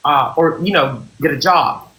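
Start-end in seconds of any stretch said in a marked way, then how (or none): none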